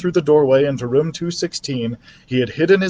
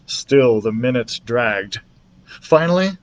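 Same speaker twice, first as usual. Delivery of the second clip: plain reading voice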